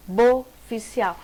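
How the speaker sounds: slow suboficial, su and bo set apart before ficial; separated syllables. Shown as a demonstration. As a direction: pause after su and after bo in suboficial. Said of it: "uma separação desse sub pro bo".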